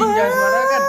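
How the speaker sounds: sudden high yell at a friend; shouting. Real, not acted. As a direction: yell it out, half crying. Friends bantering, sudden outburst.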